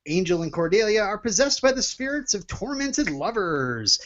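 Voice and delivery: high, loud announcer read; hammy TV announcer intro. Pretend. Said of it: "do the thing you do".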